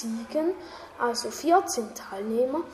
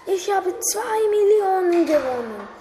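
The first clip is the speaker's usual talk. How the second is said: excited; 7 semitones higher, 4 dB louder than usual. Acted. Jake announcing the win.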